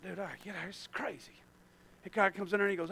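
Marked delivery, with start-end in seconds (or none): 0.0-1.3 near-whispered retold aside; very soft, secretive mutter; hushed urgency. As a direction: whisper it under your breath to a friend. Retells urgent aside during drug deal.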